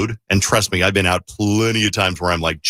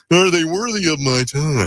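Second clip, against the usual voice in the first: high haughty imagined thought; snooty; 9 semitones higher than usual. Retold or imitated. Host voices Vaughn's imagined snobby glance, mock haughty. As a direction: sniff it out like a snob. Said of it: "as if to say".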